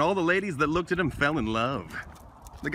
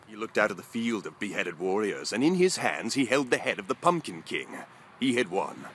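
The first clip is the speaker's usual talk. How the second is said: dramatic low narration; 7 semitones lower, 4 dB quieter than usual. Acted. Epic dark narration.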